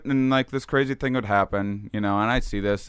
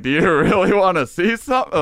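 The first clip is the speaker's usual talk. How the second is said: higher, louder with a laugh; entity teasing; 8 semitones higher, 6 dB louder than usual. Retold or imitated. Entity offering Shane a revelation, higher with a laugh. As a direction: tempt him, higher and laughing.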